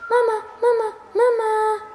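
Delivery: loud, high calling for mom. Acted. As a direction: shout across the house for mama. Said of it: "rief er".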